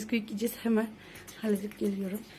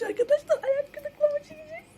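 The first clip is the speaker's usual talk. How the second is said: much higher, whiny mock cry; mock dread; 5 dB louder than usual. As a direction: wail it in playful despair. Dreads the tickling task.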